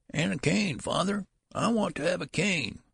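put-on high, thin voice of the strange old son; high old-man voice. Son calling after his father down the hall, high and thin. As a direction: whine it up high like a creaky old child.

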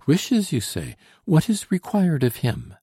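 high, urgent heroine; worried daughter's query; anxious questioning. A narrator's reading. Daughter anxiously questions her father's rescuer.

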